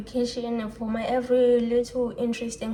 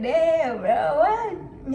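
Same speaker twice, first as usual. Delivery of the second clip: a loud, higher cry of disbelief; shocked outburst; 6 semitones higher, 6 dB louder, much slower than usual. Retold or imitated. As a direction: blurt it out, stunned and loud. Shocked reaction to father's refusal.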